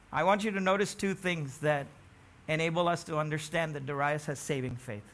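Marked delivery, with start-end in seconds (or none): none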